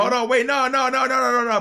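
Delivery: loud, high, rapid no no no; shocked protest. Real, not acted. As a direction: stop her in disbelief. Host reacts in disbelief.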